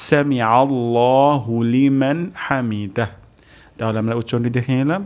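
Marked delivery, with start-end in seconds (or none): 0.1-3.1 very slow, every syllable drawn out; slow recitation. Shown as a demonstration. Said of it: "উচ্চারণটা আরেকবার করছি".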